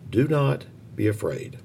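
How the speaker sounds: usual speaking voice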